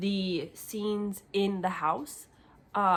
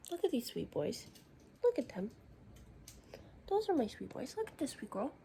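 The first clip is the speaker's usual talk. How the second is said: soft, higher, tender; tender cooing; 5 semitones higher, 8 dB quieter than usual. Real, not acted. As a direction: coo over the sweet animals. Cooing over animals in book.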